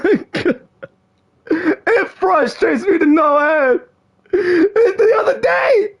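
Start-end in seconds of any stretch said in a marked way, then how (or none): 1.5-5.9 frustrated whine. Real, not acted. Continues frustrated rant.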